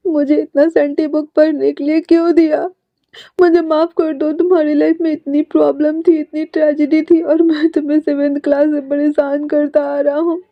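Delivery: high, tearful, choked-up pleading; crying. A narrator's reading. Jinling cries his apology to Yuvan, tearful and high. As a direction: sob out an apology to his friend. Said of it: "फिर से रोते हुए कहता है".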